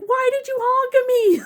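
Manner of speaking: high, loud mock wail; retold outraged demand. Hurt woman confronting the man who honked, loud mock outrage.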